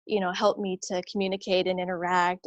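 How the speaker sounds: normal talking voice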